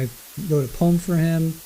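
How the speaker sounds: ordinary delivery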